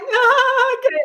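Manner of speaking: very high, wailing mock refusal; whiny refusal. Mother refusing the nurses, high mock whimper. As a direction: whimper it like a terrified wimp. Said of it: "And I was like".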